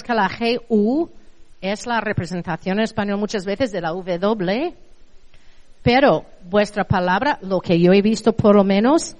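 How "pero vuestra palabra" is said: normal talking voice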